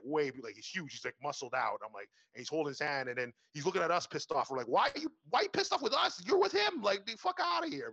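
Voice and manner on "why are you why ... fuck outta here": high-pitched, incredulous protest; indignant retort. Retold or imitated. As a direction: fire back indignantly, voice pitched way up. Indignant retort to a pissed-off guy.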